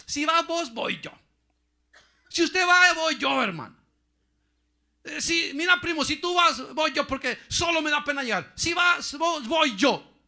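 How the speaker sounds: high, loud mocking pulpit shout; preaching at full force; shouting preacher. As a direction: hammer the point home. Preacher mocking excuses loudly.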